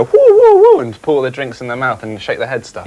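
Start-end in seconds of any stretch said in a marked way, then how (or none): 0.0-0.8 high, loud party whooping. Retold or imitated. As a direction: whoop it up like a bartender hyping the crowd. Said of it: "and you go".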